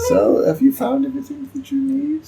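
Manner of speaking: high-pitched farmer voice throughout; fussy old farmer. Acted. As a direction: talk like a doting bird-keeper. Farmer addressing players.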